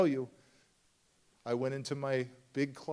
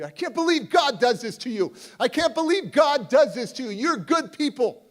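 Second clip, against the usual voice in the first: much higher and louder, furious at God on the father's behalf; angry outburst; 11 semitones higher, 11 dB louder than usual. Retold or imitated. Raging to the grieving father in the car, angry shout. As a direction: shout it out in disbelief and rage. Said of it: "I'm so angry".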